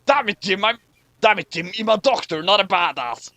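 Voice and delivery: a touch of a whiny Bones; whining Bones. Acted. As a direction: complain like an exasperated doctor. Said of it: "whined Bones".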